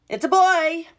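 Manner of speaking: high bright mock doctor announcing. Doctor announcing the first twin, high voice. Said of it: "They said".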